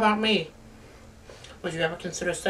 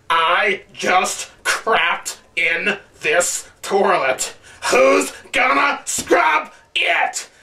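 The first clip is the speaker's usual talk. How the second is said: mock epic scream; much slower than usual. Parodying epic movie yelling, slow hammed-up shout. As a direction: yell it like a Spartan king, slowly. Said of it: "people screaming that same way".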